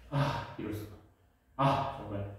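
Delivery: soft, low self-reproach; muttered dismay. Real, not acted. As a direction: grumble quietly at your own slip. Dismayed at forgetting the spelling.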